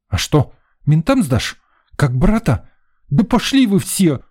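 put-on high-pitched, hurt child's defiance; boy near sobbing. A narrator's reading. Boy taunts man about police, hurt and tearful. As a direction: lash out like a kid about to cry. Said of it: "Душераздирающий всхлип вырвался".

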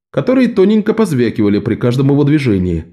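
usual speaking voice

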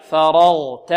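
فرغت with light ف, heavy ر and غ, light ت; model pronunciation. Shown as a demonstration. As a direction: say فرغت loudly for repetition, light first and last. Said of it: "انطقوا معي". (نطق فرغت بفاء وتاء مرققتين وراء وغين مفخمتين)

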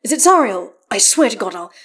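loud, urgent questioning; demanding. A narrator's reading. Astarion fearing trouble for Karlach, demanding. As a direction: push hard for an answer. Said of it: "he demands".

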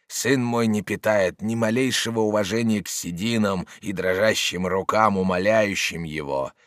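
put-on low, measured old man's plea; grave appeal. A narrator's reading. Old father imploring his son's opponent, grave and low.

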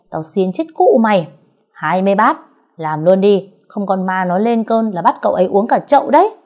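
loud frantic threats; panicked shouting. Acted. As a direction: shout out of control. Panicked threats while held down.

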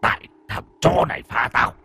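gloating vengeful spirit; vengeful ghost. Acted. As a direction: threaten like a spirit gloating over its victims. Ghost blames the boy.

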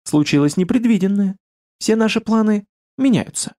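forced casual lightness; pointedly light voice. Acted. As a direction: say it airily, hiding the worry. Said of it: "подчёркнуто лёгким голосом".

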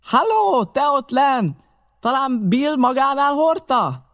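very high hailing yell; high, carrying holler; shouting. A narrator's reading. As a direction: hail someone below at full voice. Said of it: "kiáltott megint a vakkoldus".

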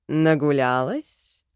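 low, slow calm male voice; calm man's voice. A narrator's reading. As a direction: ask low and unbothered. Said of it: "спокойно поинтересовался он". (спокойный низкий мужской голос)

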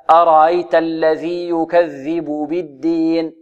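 slow recitation, the u of يكذب given full value before بالدين; both ba clearly sounded. Shown as a demonstration. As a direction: keep the two b's of يكذب بالدين distinct. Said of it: "هكذا". (يكذب بالدين بإيضاح الباءين)

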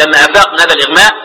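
ordinary talk

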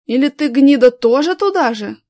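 snapping back. A narrator's reading. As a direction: snap back at him hard. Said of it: "огрызнулся третий".

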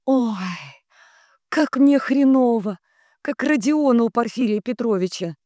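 high, loud suffering moan; wailing, worn-out complaint. A narrator's reading. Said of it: "она застонала".

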